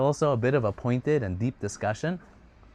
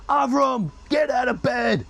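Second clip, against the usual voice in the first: shouting father; 11 semitones higher than usual. Acted. Father banging on the door, yelling his son awake. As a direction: yell him out of bed like an angry dad.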